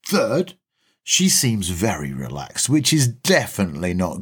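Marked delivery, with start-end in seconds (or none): none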